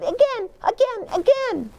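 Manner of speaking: put-on high child voice pleading again; high excited child's plea; little girl begging. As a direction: beg like an eager toddler. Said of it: "Perry said".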